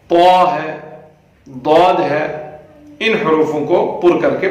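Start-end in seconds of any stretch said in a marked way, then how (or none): none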